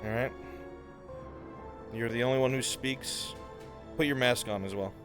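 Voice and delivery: normal talking voice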